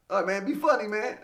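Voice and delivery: lightly voiced host daring him to be funny; mock pushy host. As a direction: toss the mic over with a teasing challenge. Host hands him the mic.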